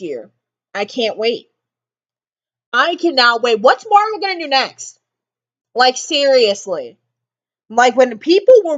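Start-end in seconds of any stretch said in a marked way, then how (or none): none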